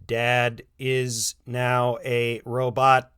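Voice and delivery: slow, even robot voice; dad playing robot for his family; robotic. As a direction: announce it like a machine, word by word. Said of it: "Ron responded robotically".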